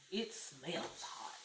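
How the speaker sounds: breathy whisper, slowed right down. Real, not acted. Cook whispering to viewers about hot salsa. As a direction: hush it like a secret about the heat.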